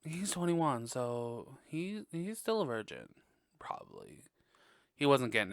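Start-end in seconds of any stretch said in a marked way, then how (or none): 3.6-4.2 breathy whispered afterthought; whispered aside. Real, not acted. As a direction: drop it to a whisper like a secret. Snarky aside after joke.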